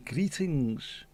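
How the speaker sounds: lightly put-on sitcom catchphrase, falling at the end; Joey Boswell. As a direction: say it as the sitcom character's greeting. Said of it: "Very Joey Boswell".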